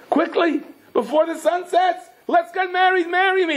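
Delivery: very high, eager proposal; urgent maiden. Acted. Maiden begs the man to marry her today, urgent and high. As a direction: plead in a high, excited voice. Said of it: "She jumps up and says".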